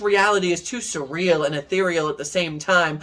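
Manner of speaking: poetry slam delivery; spoken-word poetry. Acted. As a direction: perform it as spoken word, building then settling. Performs a spoken-word poem.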